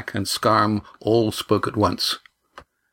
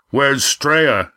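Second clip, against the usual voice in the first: demanding; 4 semitones higher, 3 dB louder than usual. A narrator's reading. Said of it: "demanded Roaring Jack".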